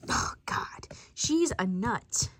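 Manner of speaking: hushed, slow whispered aside. Acted. Whispering to family that grandma's a nut. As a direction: breathe it so grandma can't hear.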